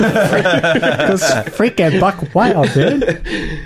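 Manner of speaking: laughing. Real, not acted. Laughing at the phrase.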